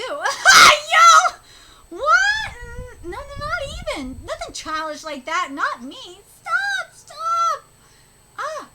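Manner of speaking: high, loud, squealing protest, laughing; a girl caught out, yelping denials; flustered girl. Acted. Flustered apprentice denying to her master, squealing high.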